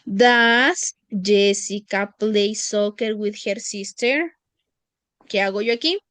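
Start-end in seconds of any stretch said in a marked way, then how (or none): 0.0-4.3 slow, clear reading of the question; deliberate classroom reading. Shown as a demonstration. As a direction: read it slowly for the class. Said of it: "Me dice".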